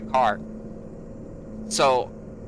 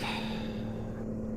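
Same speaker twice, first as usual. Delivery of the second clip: soft, drawn-out, fed-up exhale; weary sigh; 11 dB quieter than usual. Retold or imitated. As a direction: sigh before the sarcastic word. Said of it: "So I'm like".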